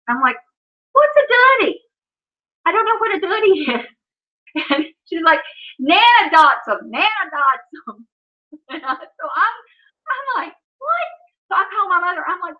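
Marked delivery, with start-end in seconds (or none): 0.9-3.9 high-pitched mock bewilderment; baffled. Mom to toddler asking for a goody, baffled. 5.8-8.1 slow "dots 'em" for "got some"; drawn-out little-child "Nana dots 'em", d for g; toddler baby talk. Retold or imitated. Toddler insisting Nana has treats, slow baby talk. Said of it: "when she was first learning to talk". 10.8-11.1 very high, baffled "What?"; incredulous. Retold or imitated. Mom not understanding her toddler, high incredulous question.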